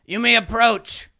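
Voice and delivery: a kobold king bidding them approach; royal command. Acted. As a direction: grant them audience grandly. King grants audience.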